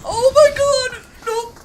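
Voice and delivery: panicked scream. Real, not acted. Monster attacks.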